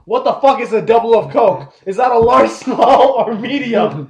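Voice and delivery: loud, high, disbelieving outburst; incredulous outrage about a confusing order; exasperated rant. Real, not acted. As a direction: vent the frustration at full volume.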